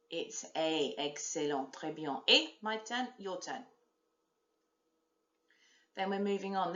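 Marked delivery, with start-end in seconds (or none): none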